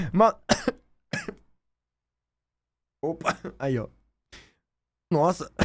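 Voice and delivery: hiccuping mid-word; interrupted by sudden hiccups. Real, not acted. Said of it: "Me deu soluço".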